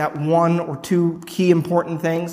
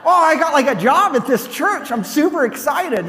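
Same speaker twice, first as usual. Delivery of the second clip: much higher, bubbly and eager; eager excitement; 9 semitones higher than usual. Retold or imitated. Newcomer telling the cashier about the job, excited and eager. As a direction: gush about the new church job.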